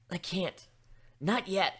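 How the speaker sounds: ordinary delivery, nothing marked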